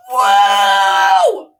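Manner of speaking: long loud whoop; triumphant yell. Real, not acted. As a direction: let out a big victory holler. Celebrating the successful take.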